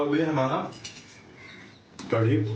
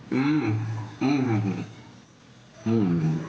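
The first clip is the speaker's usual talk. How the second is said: savoring hums. Real, not acted. Tasting pakoda, pleased hums.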